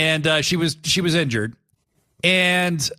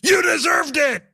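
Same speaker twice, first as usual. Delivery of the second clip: acted Hangman retort; 13 semitones higher than usual. Hangman shouting at Swerve that he deserved it. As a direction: snap it back as Hangman. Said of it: "And then Hangman goes".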